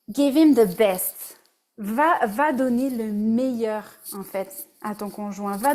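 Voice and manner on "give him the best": slow, loud English line in her best accent. Shown as a demonstration. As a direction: put on your best English accent, drawn out and emphatic. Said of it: "Je sors mon accent anglais, mon meilleur accent anglais". (meilleur accent anglais, lent et appuyé)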